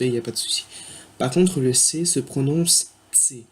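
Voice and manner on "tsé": C said as "tsé"; German letter C. Shown as a demonstration. Teacher showing French learners the German C, said "tsé". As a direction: say C with a small T in front, "tsé". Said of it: "comme s'il y avait un petit T devant".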